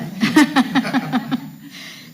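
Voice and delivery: breathy laugh to steady herself; nervous laugh. Real, not acted. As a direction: laugh off the emotion. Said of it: "let me collect myself".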